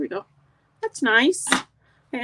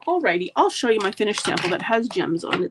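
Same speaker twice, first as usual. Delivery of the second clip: usual speaking voice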